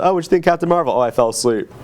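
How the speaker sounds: usual speaking voice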